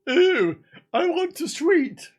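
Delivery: a hint of a spoilt posh kid asking for a sweet; half-hearted posh put-on; mock posh. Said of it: "'cause he thinks he's posh".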